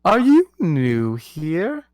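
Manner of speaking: slow, haughty royal question; queen's voice. Acted. Queen questioning the new card, slow and haughty. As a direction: look the newcomer up and down.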